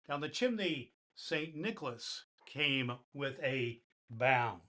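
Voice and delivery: put-on storybook reading to children; gentle bedtime poem reading; story narration. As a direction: read the Christmas poem warmly to kids. Santa reads the poem aloud.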